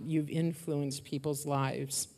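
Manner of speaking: normal talking voice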